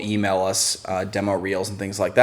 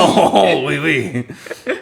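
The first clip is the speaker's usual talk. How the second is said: put-on terrible French accent; 3 semitones higher, much slower than usual. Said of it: "terrible French accent".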